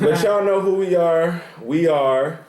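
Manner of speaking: loud, high show outro; hyped sign-off. Real, not acted. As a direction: pump up the sign-off.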